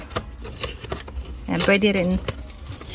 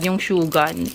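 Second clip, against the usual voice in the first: normal talking voice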